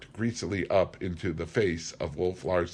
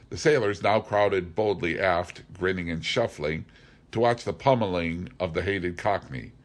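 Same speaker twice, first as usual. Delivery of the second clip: plain reading voice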